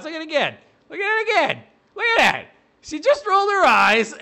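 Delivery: high, loud, gleeful replay; gleeful jeering. Real, not acted. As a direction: point it out with glee. Gleeful replay of eye roll.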